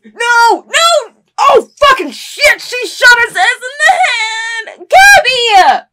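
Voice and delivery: high, loud cry of shock; horrified screaming. Real, not acted. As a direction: scream in horror at the screen. Horrified at shooting in show.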